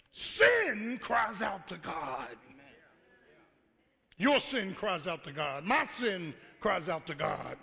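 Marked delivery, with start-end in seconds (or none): none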